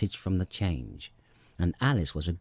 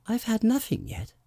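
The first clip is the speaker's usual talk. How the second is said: offended; 14 semitones higher than usual. A narrator's reading. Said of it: "in an offended tone".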